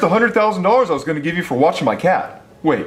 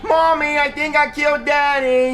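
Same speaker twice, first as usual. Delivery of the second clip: put-on kid voice; 5 semitones higher than usual. Kid character to mom.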